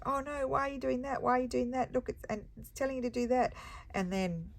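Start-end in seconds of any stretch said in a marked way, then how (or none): 0.1-3.5 high-pitched fretting, faster at the end; fussing at the driver in a high squeak; mock panic. Wife in the car panicking at husband Steffi's route. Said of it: "And I'm like".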